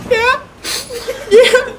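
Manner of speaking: high, squeaky yes broken by a laugh; laughing through tears. Retold or imitated. To the principal, a high squeaky laughing yeah. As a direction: force out a yeah while laughing and crying. Crying student answers the principal.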